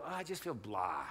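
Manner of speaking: acted-out blah mood; listless reply. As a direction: answer as if you can't name what you feel. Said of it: "He's like, like".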